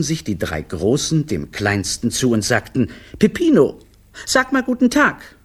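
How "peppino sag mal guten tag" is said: put-on high-pitched children's chorus voice; coaxing big children. Big siblings urging the littlest to greet, high and coaxing. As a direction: prod the littlest in a high kid voice.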